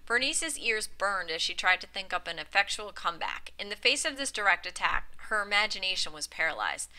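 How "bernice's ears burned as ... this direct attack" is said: usual speaking voice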